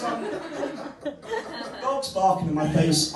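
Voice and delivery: normal talking voice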